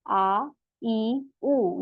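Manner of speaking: आ, ई and ऊ each held for two counts; drawn out vowel demo; long vowels aa ee oo. Shown as a demonstration. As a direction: stretch आ, ई, ऊ out slowly one by one. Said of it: "same आवाज होगी ऊ".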